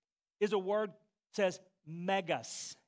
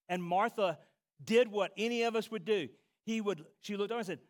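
normal talking voice

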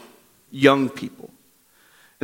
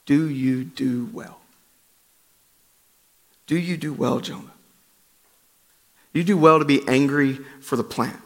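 gentle questioning God; 4 semitones lower, 6 dB quieter than usual. Acted. Preacher voicing God softly asking angry Jonah. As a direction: ask quietly, letting it sink in.

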